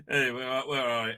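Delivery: usual speaking voice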